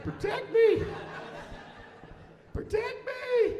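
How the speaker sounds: very high, loud comic shriek; hammed-up panicked scream for help; scared shriek. Dad hiding behind his teenage son, comic terrified shriek. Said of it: "I was so scared".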